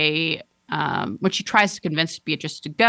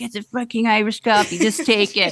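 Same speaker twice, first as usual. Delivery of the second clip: irritated bartender quote; 4 semitones higher than usual. Retold or imitated. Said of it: "She's like".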